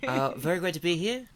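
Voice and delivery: soft, hesitant New Zealand accent; Taika Waititi. Shown as a demonstration. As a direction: voice a toy elephant in a mild New Zealand accent. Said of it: "kinda has a Taika Waititi, like, New Zealand type thing".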